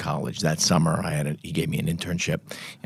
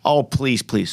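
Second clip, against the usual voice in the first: dismissive father; 6 semitones higher, slower than usual. Retold or imitated. Father dismissing son's argument.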